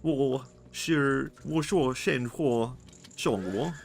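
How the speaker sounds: mock Mandarin babble in a Swedish accent; Swedish-accented Chinese. Shown as a demonstration. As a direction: fumble through made-up Chinese with a Swedish accent. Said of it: "How would I do Chinese in a Swedish accent?".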